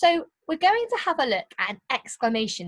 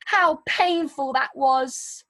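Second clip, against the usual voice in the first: pained; 4 semitones higher, 3 dB louder, slower than usual. Shown as a demonstration. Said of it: "speaking with a bit of pain in your voice".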